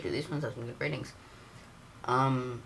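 usual speaking voice

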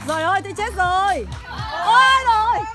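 normal talking voice

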